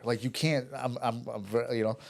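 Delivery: normal talking voice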